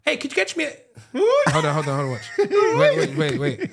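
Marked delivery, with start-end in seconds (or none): 0.0-0.7 much higher mock South African accent; a playful South African voice. Trying a South African accent for his friends. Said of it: "South African bag".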